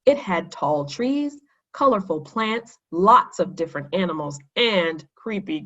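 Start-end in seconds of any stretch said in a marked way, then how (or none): none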